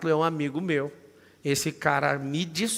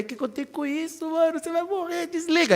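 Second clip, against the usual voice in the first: much higher, strained voice; high exasperated plea; 13 semitones higher than usual. Retold or imitated. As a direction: beg him without caring about cost. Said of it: "Aí eu falei". (súplica aguda e exasperada)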